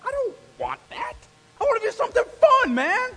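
put-on high whiny teen complaint; whiny kid protest.

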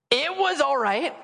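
high, rising noncommittal hedge; reluctant verdict. Retold or imitated. Acting dad's reluctant verdict.

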